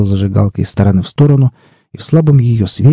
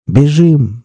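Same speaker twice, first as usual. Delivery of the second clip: закричал; 6 semitones higher than usual. A narrator's reading. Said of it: "закричал Пипец и рванул".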